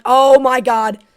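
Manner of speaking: a sudden loud gasp of disbelief; real shock at a huge catch; shocked outburst. Real, not acted. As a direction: blurt it out, stunned by the play. Big play in live game.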